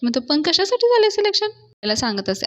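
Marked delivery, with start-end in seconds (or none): 0.2-1.5 puzzled. Retold or imitated. Raja puzzled question.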